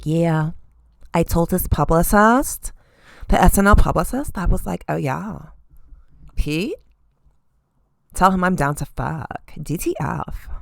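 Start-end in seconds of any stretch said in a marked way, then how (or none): 0.0-4.4 lightly mocked Kardashian accent; Kumtrashian accent. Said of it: "is that the Kumtrashian accent?". 4.9-10.5 softly mocked Kardashian accent; Kumtrashian accent. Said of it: "is that the Kumtrashian accent?".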